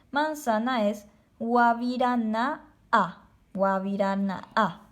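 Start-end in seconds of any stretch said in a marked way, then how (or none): 1.4-4.8 guavirana'a with a small break before the last a; slow guavirana'a, stop briefly between the two a's; teacher showing the pause in guavirana'a; pause at the glottal stop. Shown as a demonstration. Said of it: "hago una pequeña pausa".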